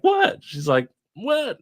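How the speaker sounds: very high-pitched mock-innocent question; caught snacker. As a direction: imitate her squeaky startled reply. Retold caught snacker's innocent reply.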